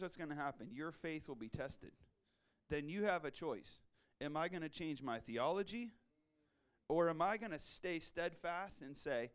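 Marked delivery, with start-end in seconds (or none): none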